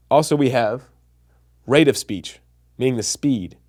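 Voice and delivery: usual speaking voice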